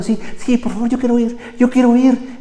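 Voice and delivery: high, excited, eager voice; Holy Spirit eagerly begging to go. Retold or imitated. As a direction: plead to be let go, bursting with eagerness. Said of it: "Y el Espíritu Santo".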